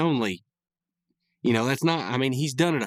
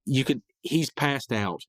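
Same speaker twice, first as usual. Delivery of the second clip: ordinary delivery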